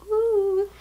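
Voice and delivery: high, drawn-out "ooh"; delighted high ooh; admiring "ooh". Real, not acted. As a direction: coo a long, high-pitched ooh. Admiring the eye shadow.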